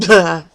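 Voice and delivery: a besotted gush of nonsense; mock gush. Real, not acted. Fan melting at the sight of Oscar, gushing. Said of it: "to put it eloquently".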